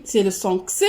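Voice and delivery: crisp "ks" sound for the letter X. Shown as a demonstration. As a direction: say X as an unvoiced "ks". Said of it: "selon la prononciation".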